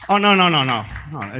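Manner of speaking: usual speaking voice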